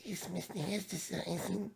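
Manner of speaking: high, soft whimpering Pikachu try; sounds like crying. Pretend. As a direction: squeak it out like a whimper. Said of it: "It sounds like I'm crying".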